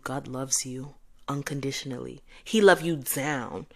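normal talking voice

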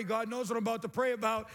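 normal talking voice

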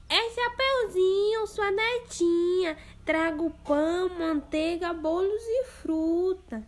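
put-on little girl voice. Girl at grandma's door, high and sweet. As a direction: announce yourself politely.

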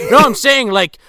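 lightly put-on Irish accent; old Irishman, Irish accent. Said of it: "not that bad at an Irish accent".

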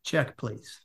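low, quiet imitation of ending the date; curt dismissal. Woman ending a first date before eating, acted quiet dismissal. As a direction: drop the voice right down, understated and final. Date ends the meal early.

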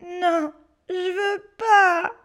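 put-on child whimpering no; whiny refusal. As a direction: drag it out like a frightened child. Scared boy refusing.

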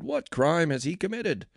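high-pitched challenge; demanding question. A narrator's reading. Pilate challenging the crowd, demanding. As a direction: press them for the charge, voice lifted. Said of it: "Pilate demanded".

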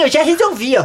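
usual speaking voice

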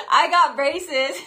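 laughing it out, slow and loud; giggling at her own luck. Real, not acted. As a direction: laugh through the words at the irony. Laughing retelling braces reward.

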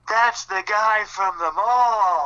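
high, slow shouted heckle; heckler in the crowd. Retold or imitated. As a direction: call it out from the stands. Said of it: "I hear somebody go".

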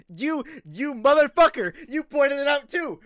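high, loud, mock-outraged yell; playful mad yell. Real, not acted. Host teased about saying else weird, loud mock-outraged yell. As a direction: yell at the friend who teased you. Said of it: "oh, you just made me mad".

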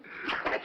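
a sudden breathy sneeze; sneezing. Real, not acted. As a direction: sneeze once, unvoiced.

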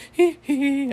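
high, loud falsetto hee hee. Retold or imitated. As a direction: falsetto pop-star hee hee hee. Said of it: "He does the [name] dance".

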